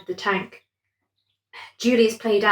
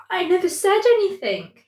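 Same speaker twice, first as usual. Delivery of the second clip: stammered; 10 semitones higher, 3 dB louder than usual. A narrator's reading. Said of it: "Julius stammered".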